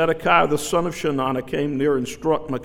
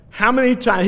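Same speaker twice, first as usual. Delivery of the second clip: exasperated king; 7 semitones higher, 5 dB louder than usual. Retold or imitated. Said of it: "the king understands the tone".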